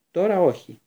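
loud, decisive no; firm refusal. A narrator's reading. Lupin refusing Harry another try, firmly. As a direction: shut it down firmly. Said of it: "είπε αποφασιστικά".

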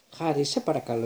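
ordinary delivery, nothing marked

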